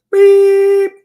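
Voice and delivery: squeaked high, loud bleep over the swear; mock censor beep. Reading a viewer's cold-weather comment, mock bleep. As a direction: bleep the word out with a cartoon beep.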